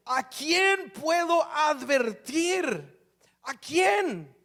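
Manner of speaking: slow, drawn-out prophetic outcry; impassioned preacher quoting a prophet; very intense. Acted. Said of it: "muy intenso esto".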